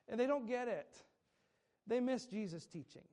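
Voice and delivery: ordinary delivery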